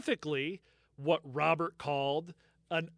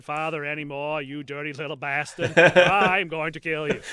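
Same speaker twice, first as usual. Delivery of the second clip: hammed-up Boston accent, louder at the end; 7 dB louder than usual. Retelling the threat in a mock Boston accent. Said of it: "You don't sound like Mark Wahlberg".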